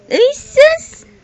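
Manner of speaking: high, loud happy squeal; delighted exclamation. Real, not acted. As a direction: burst out in delight. Delight at seeing the baby.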